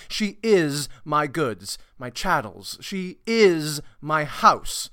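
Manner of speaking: high, loud, drawn-out stage declamation; booming theatrical Shakespeare, commanding; domineering Petruchio. Acted. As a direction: lord it over the room, stretching key words. Performs Petruchio's speech from the play.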